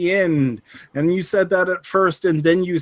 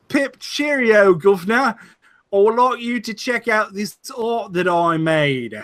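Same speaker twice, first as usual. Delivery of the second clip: mock British accent; 4 semitones higher than usual. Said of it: "your British accent is slightly more convincing".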